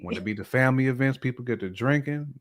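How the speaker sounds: ordinary delivery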